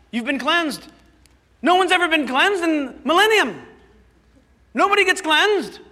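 high, loud, astonished priest; disbelieving priest. Acted. Acted priest disbelieving the leper.

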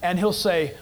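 normal talking voice

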